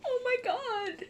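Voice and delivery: real joyful crying, barely holding together; crying happy tears. Real, not acted. Streamer moved to tears by her childhood robot, laughing through sobs. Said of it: "I'm sorry, I'm actually crying".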